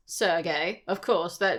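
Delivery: ordinary delivery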